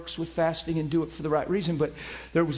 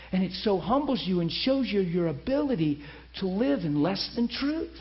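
ordinary talk, nothing marked

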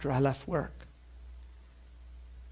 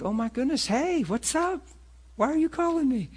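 surprised; 12 semitones higher than usual. Retold or imitated. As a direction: caught off guard by an unexpected call. Surprised by a former coworker's call.

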